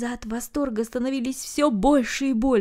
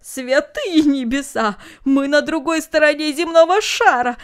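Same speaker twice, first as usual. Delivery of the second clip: loud, high astonished exclamation; big-bearded man's loud delighted cry; exclaiming; 5 semitones higher, 7 dB louder than usual. A narrator's reading. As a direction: burst out in jolly amazement. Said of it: "воскликнул Барбаросса".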